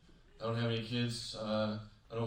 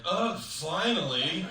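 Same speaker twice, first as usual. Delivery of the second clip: exasperated groan; 6 semitones higher, 6 dB louder, much slower than usual. Retold or imitated. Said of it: "a guy in line like".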